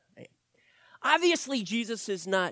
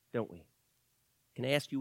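ordinary talk

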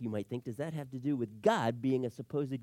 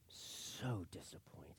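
very soft, slow, breathy letdown; acted disappointment; 9 semitones lower, 17 dB quieter, much slower than usual. Daughter let down by Christmas presents, disappointed. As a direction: sigh the words out, crestfallen.

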